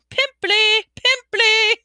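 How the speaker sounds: high, loud taunting shouts; yelling. A narrator's reading. Poltergeist chasing the crying ghost with insults, yelling.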